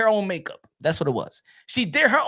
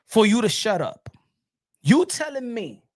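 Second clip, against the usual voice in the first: ordinary delivery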